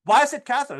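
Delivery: loud, high call; calling after someone. Pretend. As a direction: shout down the hallway. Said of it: "You with the sandwich".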